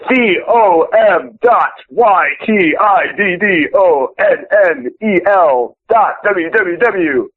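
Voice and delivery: spelling the web address backwards; slow, deliberate reverse spelling; backwards speech. Shown as a demonstration. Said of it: "I can do it backwards".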